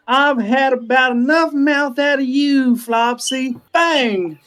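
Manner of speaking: high, drawn-out mock threat; hammed-up sheriff showdown, slow and high; play gunslinger. Aerith aims her finger gun at Flopsy, theatrical threat. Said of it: "holds up her finger gun again".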